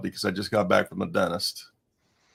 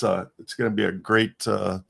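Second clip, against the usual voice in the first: ordinary talk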